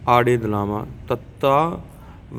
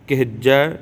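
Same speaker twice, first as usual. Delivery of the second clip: ordinary talk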